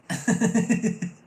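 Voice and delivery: high, loud burst of laughter; delighted laugh. Real, not acted. Reader thrilled by the guest's reading, loud high laugh.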